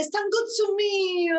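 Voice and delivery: Stanno to me said slowly and pitched high, Sicilian style; Sicilian phrase. Shown as a demonstration. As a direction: demo the Sicilian saying slowly. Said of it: "That's Sicilian".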